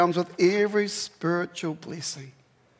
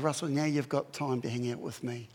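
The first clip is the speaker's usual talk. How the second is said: gentle voice of God; 5 semitones lower, 8 dB quieter than usual. Retold or imitated. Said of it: "He said".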